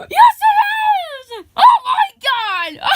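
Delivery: very high, loud squeal; ecstatic recognition. Acted. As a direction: scream with delight at seeing a friend.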